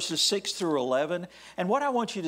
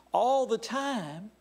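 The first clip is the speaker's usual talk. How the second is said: slow ringing call, higher; preacher's call; 6 semitones higher, slower than usual. Acted. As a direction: lead the congregation, stretched out. Preacher leads call and response.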